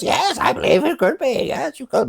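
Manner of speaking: very high, silly put-on voice; hammed-up high-pitched impostor; mock priest. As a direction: answer as a squeaky fake priest. Voicing fake priest Sir Topaz.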